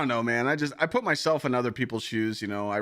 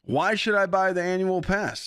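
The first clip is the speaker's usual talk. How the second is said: higher, brighter pitchman delivery; mock ad-read voice; 10 semitones higher, 5 dB louder than usual. Streamer hamming up a sponsor plug for chat, higher ad voice. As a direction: sell it like a cheesy sponsor spot. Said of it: "I'm just talking like this".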